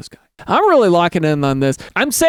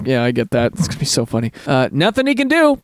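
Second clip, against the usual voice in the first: normal talking voice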